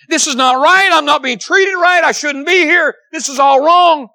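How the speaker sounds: loud, high, whining protest; indignant complaining. Acted. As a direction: rail at it like a wronged man. Voicing the complaining thief.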